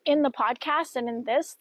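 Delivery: ordinary delivery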